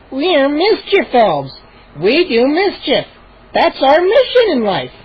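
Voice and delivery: very slow, high gnome. Acted. Gnome explaining their mission. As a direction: explain it patiently. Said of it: "Hap explained".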